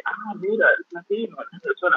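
high, delighted voice, climbing up on Venezuela; excited mock greeting. Barista retelling how locals reacted on learning his origin, mock excited. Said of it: "era como que".

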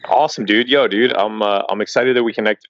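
usual speaking voice

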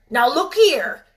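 loud, sharp rebuke. Acted. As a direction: snap it out sharply. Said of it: "he said sharply".

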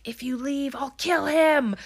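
high, creepy British threat, slowing at the end; creepy British man. Retold or imitated. Film fan imitating the masked man threatening Greta, creepy British voice. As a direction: mimic a creepy man's threat, drawing out the last words. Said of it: "like, British voice".

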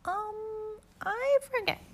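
very high small voice; small child voice. Acted. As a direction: answer like a sulky toddler. Pookie answers.

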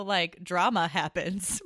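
ordinary talk, nothing marked

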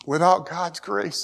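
ordinary talk, nothing marked

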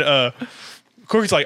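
ordinary delivery

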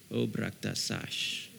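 slow, soft murmur in tongues; speaking in tongues. Real, not acted. Preacher slips into tongues mid-reading, soft and slow. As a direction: drop into a quiet prayer language.

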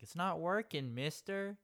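put-on high, whiny little kid. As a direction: play a pestering young boy. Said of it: "he's like".

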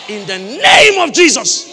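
very loud, slow declaration; full-force preaching cry; pulpit shout. Real, not acted. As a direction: shout it as a blessing over them.

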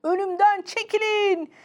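high, urgent driver's cry; shouted warning. Acted. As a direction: call out to clear the way. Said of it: "diye bağırdı kamyonun şoförü".

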